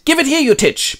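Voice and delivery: high, loud mock fury; Boromir. Boromir loses patience with Frodo, high angry shout. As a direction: snap a hammed-up demand. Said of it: "ticks out and goes".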